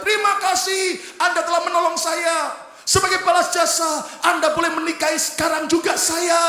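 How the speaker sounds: high shouted eager offer; desperate shouted thanks. Acted. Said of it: "wanita ini berkata".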